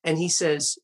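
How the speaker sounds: ordinary talk, nothing marked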